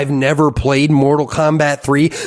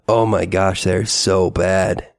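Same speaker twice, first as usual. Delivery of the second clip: normal talking voice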